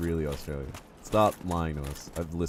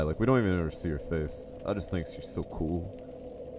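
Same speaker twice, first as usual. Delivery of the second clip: usual speaking voice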